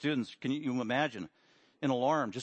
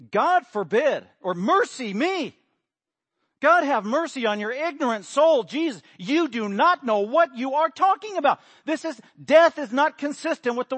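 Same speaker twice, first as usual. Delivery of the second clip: loud, much higher scolding rebuke; Peter laying into Jesus; 9 semitones higher, 9 dB louder than usual. Acted. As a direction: scold him like a shocked elder. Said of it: "he is just laying into Jesus".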